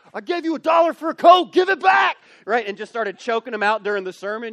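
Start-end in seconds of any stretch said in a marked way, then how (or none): 0.1-2.2 loud, much higher pretend yell; mock shout. Pastor pretending to choke a kid, yelling for a dollar.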